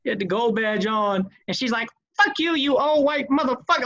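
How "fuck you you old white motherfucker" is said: higher-pitched imitated tirade of abuse; imitated angry cussing. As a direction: mimic her cussing out the manager. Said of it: "she was going in".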